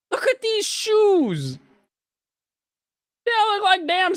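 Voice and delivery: high-pitched, loud squawk of disbelief; baffled outburst, squealed loudly; exasperated rant. Real, not acted. Baffled rant at shoe collabs.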